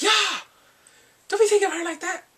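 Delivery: very high, loud mock outrage; acted indignant shout. Boyfriend warning his friend off his girlfriend, mock shouting. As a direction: yell it as the offended boyfriend. Character snaps at friend's envy.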